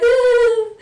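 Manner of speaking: high squeaky caught-out giggle; a friend's guilty giggle when caught lying; guilty little laugh. Retold or imitated. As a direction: imitate her nervous tell, high and quick. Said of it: "this little laugh sort of thing".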